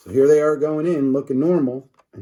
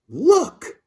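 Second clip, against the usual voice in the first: a much higher, loud cry; excited cry; 14 semitones higher, 3 dB louder than usual. Acted. Boy spotting changed kids, high excited cry. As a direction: point and yelp in alarm. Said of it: "cried George".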